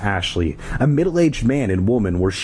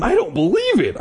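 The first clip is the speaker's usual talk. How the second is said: high-pitched cry of disbelief; acted incredulous outcry. A narrator's reading. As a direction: cry it out, much higher. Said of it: "I cried".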